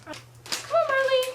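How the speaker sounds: high, loud sing-song call; calling the cat. Real, not acted. Owner calls her cat Marley, high and loud. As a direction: call the cat sweetly from afar.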